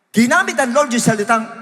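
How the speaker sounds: ordinary delivery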